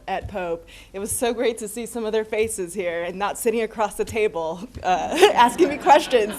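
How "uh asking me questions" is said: laughing through the words; giggling while she talks. Real, not acted. Laughs at her own joke.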